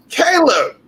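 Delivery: high, excited call of a name. Real, not acted. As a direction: greet the guest with a big rising-pitched shout-out. Host calls on her guest.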